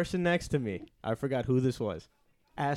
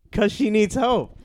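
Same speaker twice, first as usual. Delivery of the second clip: retold exasperated shout; 9 semitones higher, 8 dB louder, slower than usual. Retold quarrel with the teacher.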